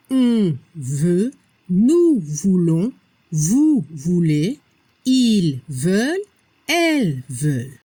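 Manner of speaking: slow, low recital from "Je veux" to "elles veulent", each word separated; repeat-together drill. Shown as a demonstration. Teacher leading learners through the conjugation together, slow low drill from "Je veux". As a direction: lead a measured drill from "Je veux" to "elles veulent", lifting each item until a final fall. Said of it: "Repetimos juntos".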